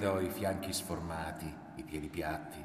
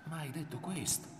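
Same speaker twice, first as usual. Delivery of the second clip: incredulous protest; 7 semitones higher, 5 dB quieter than usual. A narrator's reading. Mother questions the wild accusation.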